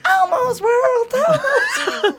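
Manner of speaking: very high, slow squeaky puppet voice; Elmo impression. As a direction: shout it like a giddy puppet. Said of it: "your Elmo impression was absolutely fucking amazing".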